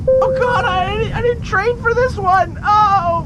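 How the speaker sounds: frantic, voice way up in alarm; panicked pilot. Acted.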